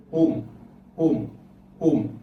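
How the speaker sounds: Home with the first consonant dropped, just "ohm"; isolating the rhyme sound. Shown as a demonstration. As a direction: sound out Home as a punched "ome", long O closing on M. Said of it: "That's what I'm hearing".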